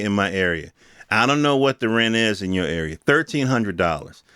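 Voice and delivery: normal talking voice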